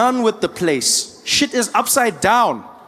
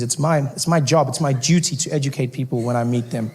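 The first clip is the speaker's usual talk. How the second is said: ordinary talk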